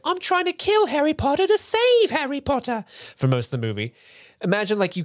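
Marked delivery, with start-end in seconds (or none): 0.0-2.8 high squeaky elf; Dobby voice. Retold or imitated. Co-host playing Dobby in a high elf squeak. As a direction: squeak the line like a proud house elf. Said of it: "he's like".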